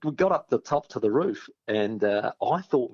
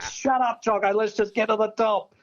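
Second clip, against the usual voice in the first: snapping; 9 semitones higher than usual. Retold or imitated. Snapping at chatty mate on ladder.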